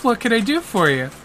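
high cheerful offer of help; chirpy receptionist; bubbly lady. Acted. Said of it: "And she goes".

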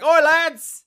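loud high Cockney shout; Cockney accent. Retold or imitated. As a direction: bellow it like a Cockney. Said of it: "like, a Cockney accent".

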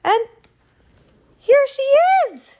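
very high, loud cub shriek; squeaky bear cub, pausing before the reveal; crying Baby Bear. Acted. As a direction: squeal the discovery as a tiny bear. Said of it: "cried the baby bear".

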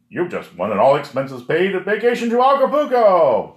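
high, loud game-show host voice; booming prize announcer. Pretend. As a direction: sell it like a TV prize reveal.

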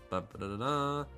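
ordinary delivery

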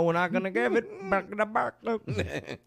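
squawky imitation of Iran; lightly clucking mock voice; chicken-like Iran voice. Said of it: "Sounded like a chicken".

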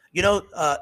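ordinary talk

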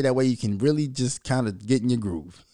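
ordinary talk, nothing marked

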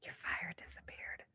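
hushed whisper, barely voiced. Real, not acted. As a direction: breathe it softly like a secret. Whispered aside.

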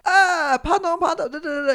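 high-pitched babbling imitation of a yelling child; mock outraged kid. Child confronting a passer-by over coins, mock high-pitched babble. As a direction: mimic him in a high, jabbering squeal. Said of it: "He comes up to me and he's like".

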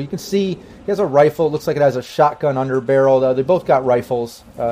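usual speaking voice

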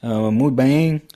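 imitating a Portuguese accent in Spanish, slowing at the end. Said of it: "But he's like".

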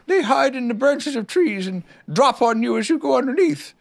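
high-pitched bear explaining; knowing Pooh Bear. A narrator's reading. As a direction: explain it simply in a high character voice. Narrator voices Pooh.

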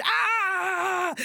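high frightened scream. Acted. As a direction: shriek in terror. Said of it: "The man screamed".

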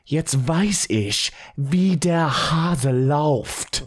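measured, drawn-out German line; slow, deliberate German. Acted. Betrayed husband to his cheating wife, slow deliberate German. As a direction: say it slowly, weighing each word. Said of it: "You could say".

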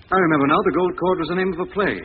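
blurting out the breakthrough; sudden realisation. Acted. Lamont to Margot and the commissioner, excited at the breakthrough.

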